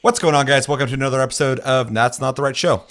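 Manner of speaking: podcast host intro. Acted. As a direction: greet the audience like a show host.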